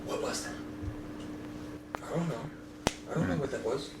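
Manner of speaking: hushed nervous whisper between sailors. Acted. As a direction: whisper it as if scared of being heard. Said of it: "you kinda hear one whispering to the other".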